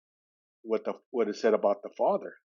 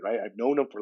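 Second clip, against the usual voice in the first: ordinary talk, nothing marked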